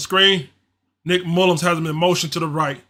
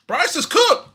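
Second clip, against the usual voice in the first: much higher, rising verdict; exasperated outburst; 11 semitones higher, slower than usual. Real, not acted. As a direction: declare him finished in disbelief. Fan giving up on quarterback.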